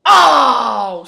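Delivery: loud drawn oh; stunned loud oh; loud shout. Real, not acted. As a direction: bellow the finish. Finish of match.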